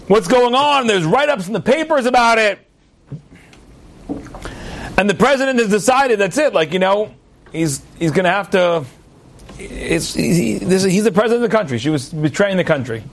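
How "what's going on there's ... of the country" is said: impassioned preaching. Acted. Rabbi telling the Purim parable, preaching with rising fervor. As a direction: preach it out to the congregation with fire.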